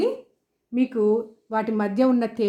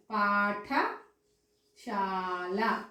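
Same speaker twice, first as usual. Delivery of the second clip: పాఠశాల very slow and softer, paused after పాఠ; lower, long-stretched paatha... shaala in పాఠశాల; syllable by syllable; 4 semitones lower, 5 dB quieter, much slower than usual. Shown as a demonstration. Teacher spelling it out for children, broken slow పాఠశాల. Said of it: "అప్పుడు మనము ఈ ఠ రాయాలి".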